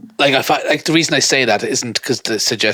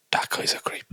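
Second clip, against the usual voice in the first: hushed, voiceless aside; muttered aside; 12 dB quieter than usual. Acted. Imagined onlooker judging a man asking to adopt, whispered. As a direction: whisper it like a private judgement.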